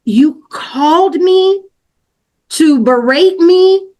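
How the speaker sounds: high, slow, each word spaced in disbelief; incredulous outrage. Retold or imitated. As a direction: stretch it out like you can't believe she did this. Retelling disbelief at boss's call.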